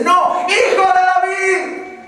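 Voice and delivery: very high, drawn-out full shout; refuse, then belt out the call; defiant crying out. Retold or imitated. Blind man defies those silencing him, crying out. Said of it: "esos gritos eran".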